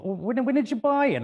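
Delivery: high, loud mock mechanic voice; hammed-up high repeat of the question; mimicking the mechanic. Speaker mocking the mechanic's question, high and loud.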